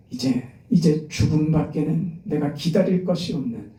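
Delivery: normal talking voice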